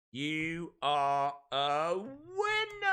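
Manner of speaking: slow, drawn-out announcer call; game-show style slow reveal; winner announcement. Pretend. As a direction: stretch it out and swoop up on the last word.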